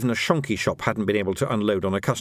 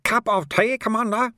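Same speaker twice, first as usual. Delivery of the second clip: high, chirpy offer of tea; cheerful; 11 semitones higher than usual. A narrator's reading. Monk greets a fallen commander with tea, cheerful. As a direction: offer it brightly, pitched well up.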